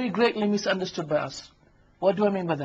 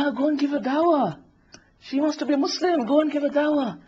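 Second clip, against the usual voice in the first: high-pitched, coaxing tempter; Shaitan; 8 semitones higher than usual. Acted. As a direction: nudge the listener on in a higher voice. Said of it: "And he says".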